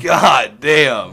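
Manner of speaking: loud amazed exclamation; excited exclaim. Real, not acted. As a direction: burst out in awe. Praising friend's final thoughts.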